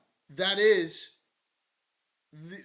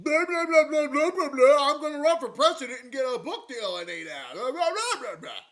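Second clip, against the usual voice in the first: higher, louder sneering blah blah; mocking blah blah; 5 semitones higher, 5 dB louder than usual. Host mocking the secretary's empty answers, loud sneer. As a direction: ridicule the empty talk, loud.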